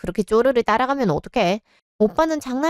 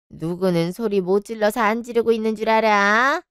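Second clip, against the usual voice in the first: ordinary delivery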